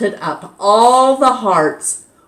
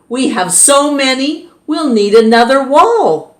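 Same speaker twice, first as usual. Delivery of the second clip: exclaiming; 7 semitones higher, 4 dB louder than usual. A narrator's reading. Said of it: "Missus Ruler exclaimed".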